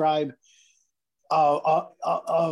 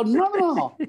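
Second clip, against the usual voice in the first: put-on little boy clutching his glove, refusing; stubborn kid refusing; 10 semitones higher than usual. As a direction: whine it like a defiant eight-year-old.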